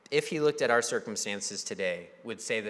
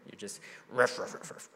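ruff ruff fading to a breathy mutter; teasing himself for grumbling; mock grumble. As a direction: grumble at yourself under your breath. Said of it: "he's just grumbling".

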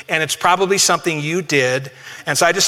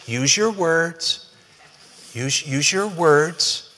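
put-on slow, deliberate coaxing of a small child; very slow, patient parent voice; much slower than usual. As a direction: spell it out gently to a toddler. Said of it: "you'll even say things like this".

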